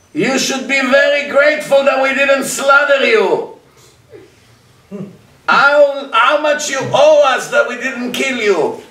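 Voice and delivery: high and loud, scornful; indignant shout. Acted. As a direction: throw it at him as an outraged gentile. Goyim retort, voiced indignant.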